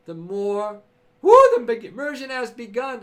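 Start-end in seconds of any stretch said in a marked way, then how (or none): 1.2-3.0 loud, jubilant proclamation; excited loud cheer. Real, not acted. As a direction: cheer it like a launch. Celebrating the immersion launch.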